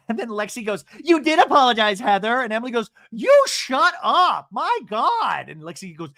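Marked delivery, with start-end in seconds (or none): 1.0-2.4 loud, raised-pitch mock retort; mock arguing. Acting out the quarrel. 3.1-5.5 loud, high, slow mock outburst; mock furious. Acting out the quarrel.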